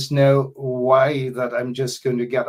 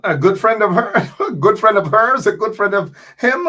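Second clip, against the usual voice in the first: laughing while correcting himself; 9 semitones higher, 7 dB louder than usual. Real, not acted. Radio host mocking a guest's answer, laughing. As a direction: chuckle through the words, amused.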